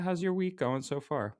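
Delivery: ordinary talk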